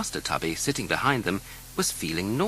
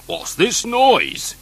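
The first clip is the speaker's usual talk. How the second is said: grumbling policeman; 9 semitones higher, 8 dB louder, much slower than usual. Acted. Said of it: "he muttered".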